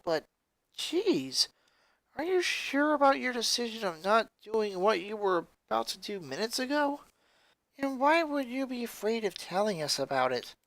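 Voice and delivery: a friend stunned by hard news; character voice. Acted. Character dialogue voiced by reader.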